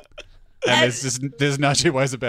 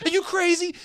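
much higher, exasperated mock guide voice; incredulous guide. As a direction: play the guide, stunned they don't get it. Guide insists it's rare.

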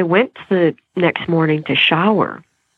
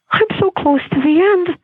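much higher, eager; high excited thought; 13 semitones higher than usual. Retold or imitated. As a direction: lift it up hopefully. Retold hopeful thought mid-ride.

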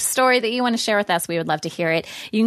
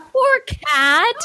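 high squealing laugh into poor cat; real burst of laughter over the cat; 9 semitones higher than usual. Real, not acted. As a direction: laugh it out, half crying with amusement.

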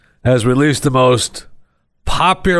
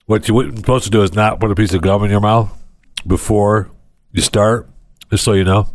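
normal talking voice

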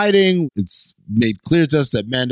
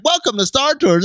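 high, bright robotic greeting; Star Tours droid; 12 semitones higher than usual. Retold or imitated. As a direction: greet riders like the ride's droid. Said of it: "the droid who is the one who's like".

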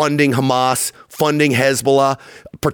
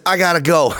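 mock chuckle; 2 semitones higher than usual. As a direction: imitate a dismissive chuckle after a brief sign-off. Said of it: "he chuckles walking off the stage".

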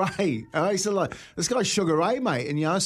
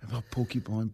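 quiet self-doubt; 6 semitones lower, 7 dB quieter than usual. Retold or imitated. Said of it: "I'm walking past and going".

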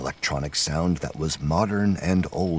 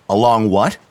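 loud, higher called-out question; calling out; 5 semitones higher, 9 dB louder than usual. A narrator's reading. McBride interrupting as he arrives at the cave, calling out. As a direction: call it across to the group. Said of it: "McBride called".

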